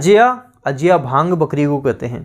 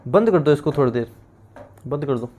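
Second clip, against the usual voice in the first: quick aside; 4 semitones lower, 5 dB quieter, much faster than usual. Real, not acted. Aside to someone off-lesson.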